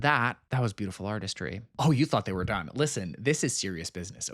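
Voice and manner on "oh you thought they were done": ordinary talk, nothing marked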